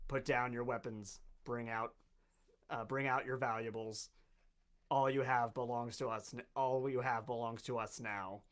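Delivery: low, quiet giant robot announcement; loudspeaker robot voice. Acted. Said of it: "you hear a booming voice out of a loudspeaker".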